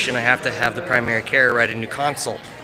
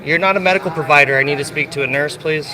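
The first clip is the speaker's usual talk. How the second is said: angry demand; 3 semitones higher, 6 dB louder than usual. Real, not acted. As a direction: push hard, losing patience. Demanding a nurse, escalating.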